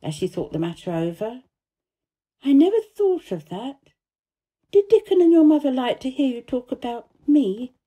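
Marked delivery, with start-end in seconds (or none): 2.4-7.7 put-on higher, louder girl, questioning; little girl from India. A narrator's reading. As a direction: ask it in a pitched-up little girl's voice. Narrator voices Mary.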